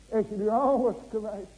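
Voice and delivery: loud, driving sermon voice; preaching at full force to the church; fervent preaching. Acted. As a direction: hammer each question home to the congregation. Preaching peak.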